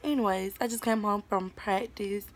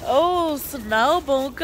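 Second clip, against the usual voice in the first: excited; 7 semitones higher, 9 dB louder, much slower than usual. Real, not acted. Kids playing in snow.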